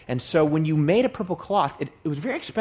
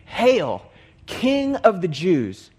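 raised, drawn-out mocking hail; mock homage; 4 semitones higher, 4 dB louder, much slower than usual. A narrator's reading. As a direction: proclaim it slowly in mild mock reverence. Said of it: "They're mocking him as king".

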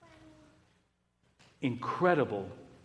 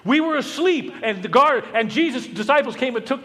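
high, loud mocking voice of the guards; mock guards' excuse; 8 semitones higher, 7 dB louder than usual. Preacher mocking the guards' story, high and loud. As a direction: send up the guards' excuse, much higher and louder.

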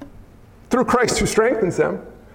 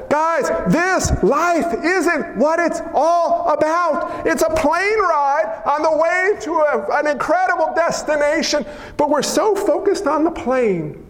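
higher, slow and drawn out; slow emphatic preaching; 5 semitones higher, slower than usual. Acted. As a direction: lean in and stretch every word.

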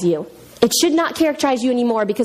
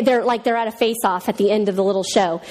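usual speaking voice

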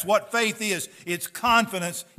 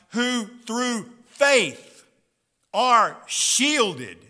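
emphatic reading; 5 dB louder than usual. Acted. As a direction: lean on every word. Slow emphatic scripture reading.

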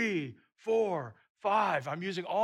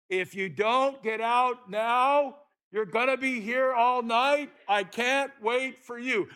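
loud, slow, higher guide yelling; retold yell; 7 semitones higher, 8 dB louder, slower than usual. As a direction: bark it at him like an impatient guide. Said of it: "and he yells at me".

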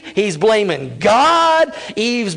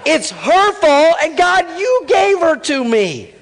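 whiny blame; 8 semitones higher, slower than usual. Retold or imitated. Adam whining to God that it's her fault. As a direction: whine it like a child passing blame. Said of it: "he's like".

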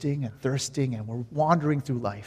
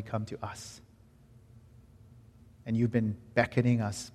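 prayer. Real, not acted. Leads closing prayer.

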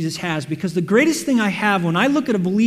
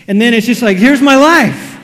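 exasperated outburst; 3 semitones higher, 10 dB louder than usual. Retold or imitated. Preacher mocking his own routine, loud and fed up. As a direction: throw it out like you're sick of it. Sums up the mundane life.

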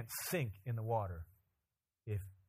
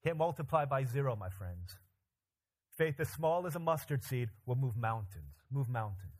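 loud, much higher, driving preacher's voice; full-force preaching; 4 semitones higher, 4 dB louder than usual. Acted. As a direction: preach it out to the back row. Preaching peak again.

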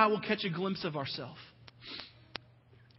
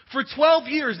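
ordinary delivery

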